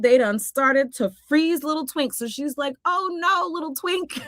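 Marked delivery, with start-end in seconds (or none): 2.8-4.2 high, pitying oh no; hammed-up concern in a high voice; mock dismay. Wisp sees little Twink freezing, high mock dismay.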